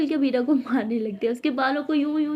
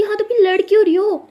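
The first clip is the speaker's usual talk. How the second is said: higher, louder exasperated shout; heated shout; 8 semitones higher, 9 dB louder than usual. Acted. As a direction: demand an answer. झगड़े में तीखा सवाल. (चिढ़कर चिल्लाते हुए)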